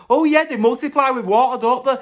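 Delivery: high hammed-up teacher; mock teacher. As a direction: play a smug schoolteacher. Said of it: "pours some water on him and goes".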